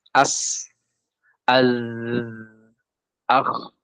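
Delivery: "As, az, akh" with long streaming endings; flowing letters. Shown as a demonstration. Teacher recaps flowing letters, "As, az, akh" drawn out. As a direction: sustain s, z and kh in "As, az, akh". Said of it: "Sisanya semua ngalir".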